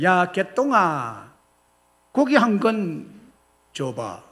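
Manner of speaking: drawn-out haughty call, then curt drop; arrogant order. Retold or imitated. Nobleman ordering meat from the butcher he looks down on, arrogant. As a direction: order him about like an underling. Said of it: "소리를 질렀습니다. ... 거만하게".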